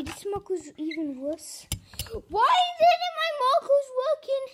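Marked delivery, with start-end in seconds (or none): 2.3-4.5 loud, high-pitched frustrated cry; frustrated wail at a broken marker; exasperated outburst. Real, not acted. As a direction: complain loudly, voice shooting up. Marker fails, child complains loudly.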